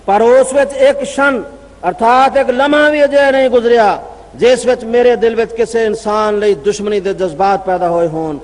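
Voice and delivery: ordinary talk, nothing marked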